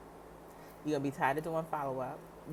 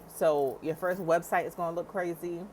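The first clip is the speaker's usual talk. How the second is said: usual speaking voice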